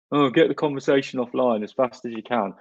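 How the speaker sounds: put-on northern English accent. Conference speaker trotting out stock troll advice, northern accent. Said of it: "I don't know why I did a northern accent then".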